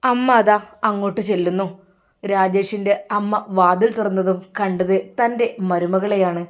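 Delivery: plain reading voice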